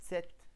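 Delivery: name the letter ست clearly. Shown as a demonstration. The teacher repeats the letter's name. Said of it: "اسمه".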